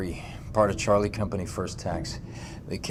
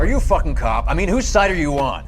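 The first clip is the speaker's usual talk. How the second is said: sharp, raised outburst; angry; 5 semitones higher, 4 dB louder than usual. Acted. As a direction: confront him hotly. Lashing out at interrogator.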